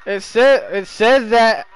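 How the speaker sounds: loud, irritated outburst; real heated shouting at a friend; angry shout. Real, not acted. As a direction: yell it back in annoyance. Snaps back in an argument.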